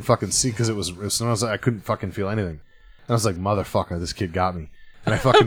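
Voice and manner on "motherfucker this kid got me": low, quiet aside to yourself; soft, lowered inner voice; retold dawning realization. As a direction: drop it down as a private curse. Retold realization the high has hit.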